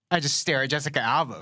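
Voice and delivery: normal talking voice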